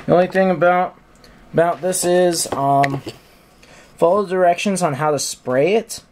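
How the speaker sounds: talking around a paintbrush held in the mouth. Real, not acted. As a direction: speak with a brush between your lips. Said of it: "I have a paintbrush in my mouth".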